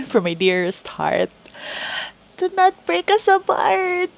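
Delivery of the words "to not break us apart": real tears breaking through the words; crying as the memory hits. Real, not acted. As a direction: voice cracking high into real tears. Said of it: "Sorry, I got emotional".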